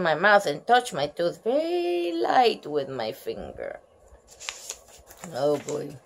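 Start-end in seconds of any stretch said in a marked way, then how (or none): none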